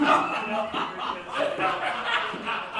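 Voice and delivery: airy burst of laughter; breathy laugh. Real, not acted. Poet laughing at a friend's heckle, breathy laugh. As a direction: laugh at the joke.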